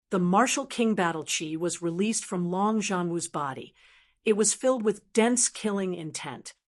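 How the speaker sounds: ordinary delivery, nothing marked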